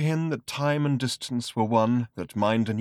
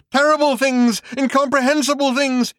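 high, much louder frantic shout; 8 dB louder than usual. Acted. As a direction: shout it out, raving. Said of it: "he shouted".

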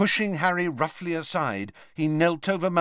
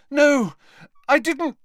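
high, loud, shocked gasp; panicked high-voiced protest; horrified denial; 13 semitones higher, 5 dB louder than usual. A narrator's reading. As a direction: blurt it out aghast, pitch raised. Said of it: "gasped Harry".

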